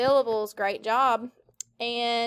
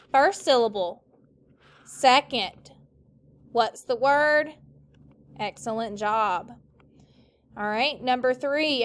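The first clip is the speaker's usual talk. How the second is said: ordinary talk, nothing marked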